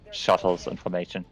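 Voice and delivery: usual speaking voice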